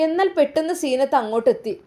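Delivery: narrating a family drama aloud; story narration. A narrator's reading. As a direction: announce the turn in the story. Narrator scene setting.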